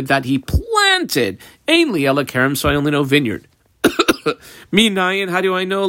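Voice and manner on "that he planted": that he planted, slow, with weight on planted; emphasis on planted. Shown as a demonstration. Teacher stressing who planted to the class, slow and weighty. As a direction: lean on planted to show the emphasis. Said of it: "Seems like more the emphasis is on the".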